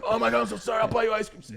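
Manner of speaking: put-on boy begs the girl he slapped for forgiveness; panicked apology. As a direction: babble your apology in a panic. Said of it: "I was like".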